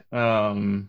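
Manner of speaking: usual speaking voice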